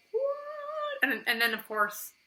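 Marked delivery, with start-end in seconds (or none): 0.1-1.0 very high stunned what; shocked what. Retold or imitated. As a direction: squeak out total shock. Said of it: "so I was like".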